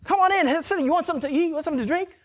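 high-pitched, over-eager host; hammed-up high salesman voice; used car salesman parody. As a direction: imitate a pushy salesman welcoming a guest. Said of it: "like, a used car salesman or something".

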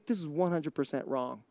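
hushed aside. Real, not acted. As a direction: drop it low, almost under your breath. Confidential aside before firm repeat.

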